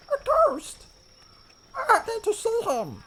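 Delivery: very high, squeaky gnome; a tiny determined gnome. Acted. Alvin insists on seeing the doctor, very high. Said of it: "the voice of Alvin".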